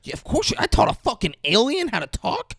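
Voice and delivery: put-on high, hyped-up little-girl boast; acted proud excitement. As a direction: brag in mock disbelief. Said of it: "She's so proud".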